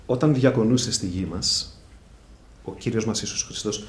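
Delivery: ordinary talk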